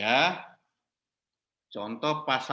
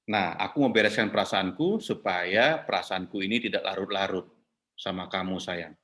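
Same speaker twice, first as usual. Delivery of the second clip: low and fading to softer at the end; wife closing her sharing softly, falling tone; 8 semitones lower than usual. Shown as a demonstration. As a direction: let it sink gently to a tender close. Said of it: "dengan intonasi ke bawah".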